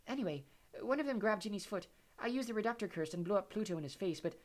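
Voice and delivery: high, airy dreamy voice; dreamy Luna. Acted. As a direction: tell it airily. Luna recounts.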